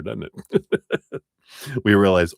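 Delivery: ordinary delivery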